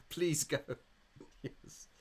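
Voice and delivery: ordinary delivery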